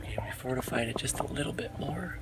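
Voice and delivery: soft unvoiced whisper. Real, not acted. Updating viewers from inside the hidden fort, whispering. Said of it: "I gotta be quiet".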